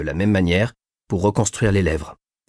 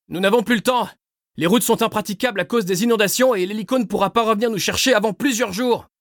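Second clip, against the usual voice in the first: shouting; 13 semitones higher than usual. A narrator's reading. Pilot shouting over the rotors.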